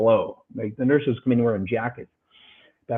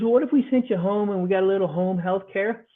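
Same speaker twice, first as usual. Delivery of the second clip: a lightly voiced doctor, pitched higher, offering excuses; mocking the doctor's home-care excuse; 8 semitones higher than usual. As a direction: lift the pitch for the smooth doctor.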